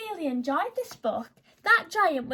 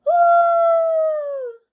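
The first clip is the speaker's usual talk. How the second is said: a long, high, loud howl; dog howling; 11 dB louder than usual. Acted. The dog howls beside the bog, long and loud. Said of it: "who was howling".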